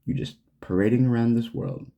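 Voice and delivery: ordinary talk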